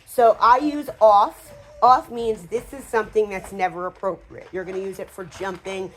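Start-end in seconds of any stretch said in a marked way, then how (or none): 0.1-1.5 slow, louder "off" said firmly; firm command word. Shown as a demonstration. Trainer showing the command off, slow and firm. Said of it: "introducing the command word".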